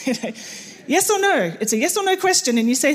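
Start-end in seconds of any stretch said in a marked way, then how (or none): 0.9-1.6 higher, pleading yes or no; pressing for a straight answer; mock exasperated plea. Missionary pressing Turkish guest for answer, exasperated. Frustrated question retold.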